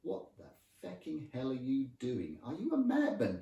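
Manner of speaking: high, incredulous outburst; appalled disbelief. Acted. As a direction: demand it as if he's crazy. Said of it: "Wow was meant in a".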